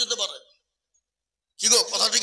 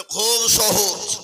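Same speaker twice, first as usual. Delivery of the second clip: very slow; 3 semitones lower, much slower than usual. Retold or imitated. The boy answering the professor, very slowly. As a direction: drag it out, very slow and easy. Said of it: "বলে".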